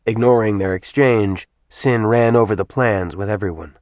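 ordinary delivery, nothing marked

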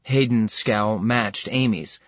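plain reading voice

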